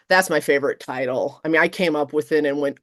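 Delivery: usual speaking voice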